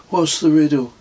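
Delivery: put-on high eager kid asking; eager boy. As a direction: voice Kez as a keen young lad. Young Kez asks eagerly.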